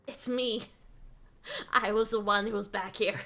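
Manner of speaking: restrained, playful retake of a reveal; deadpan playful confession; mock understated reveal. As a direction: reveal yourself coolly, without shouting. Redoes reveal after repositioning, subdued.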